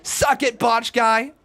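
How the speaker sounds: loud jeering gloat; loud taunt. Real, not acted. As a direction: gloat loudly at him. Taunting a chat user after win.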